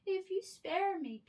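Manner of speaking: high, loud squeaky plea; pleading mouse. A narrator's reading. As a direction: beg like a tiny mouse. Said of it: "pleaded the mouse".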